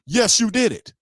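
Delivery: sharp, high insistence; insistent, high. Real, not acted. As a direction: insist he did it. Calling out Derek Carr.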